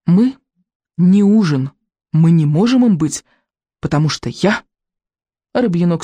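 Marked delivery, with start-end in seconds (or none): 0.0-4.6 put-on boy boldly announcing, with weighty pauses; puffed-up, halting proclamation; proud declaration. A narrator's reading. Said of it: "гордо выпитив грудь".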